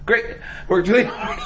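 mock cheerful, bitter underneath; sarcastic. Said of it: "and I'm like".

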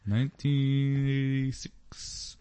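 slow imitated Indian accent, drawn out. Imitating a store clerk checking an ID, slow Indian accent. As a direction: read it out slowly in a mock Indian accent. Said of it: "something Indian-ish".

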